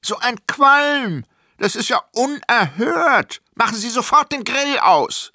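high, loud, scolding woman next door; grumbling neighbour. A narrator's reading. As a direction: complain indignantly in a shrieky high voice. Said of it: "die Stimme unserer meckernden Nachbarin".

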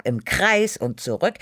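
ordinary delivery, nothing marked

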